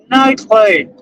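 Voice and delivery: ordinary talk, nothing marked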